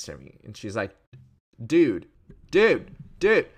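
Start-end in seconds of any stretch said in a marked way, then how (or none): 1.6-3.5 loud, rising calls of dude, each one higher; retold yell. Old woman at the crosswalk yelling at scooter rider, loud calls. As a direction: shout dude at the scooter rider like an angry pedestrian. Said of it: "like, yelling".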